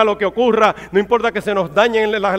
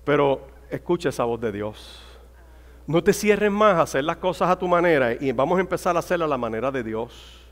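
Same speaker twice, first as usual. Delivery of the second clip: low, soft pastoral appeal; hushed solemn preaching; 6 semitones lower, 7 dB quieter than usual. Real, not acted. Preacher urging flock to hear God's voice, hushed. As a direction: drop right down, sinking to very quiet at the end.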